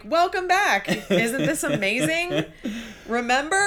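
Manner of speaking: high, slow teasing voice; sing-song mocking tone; mock welcome back. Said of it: "so you're like".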